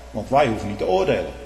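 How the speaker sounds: usual speaking voice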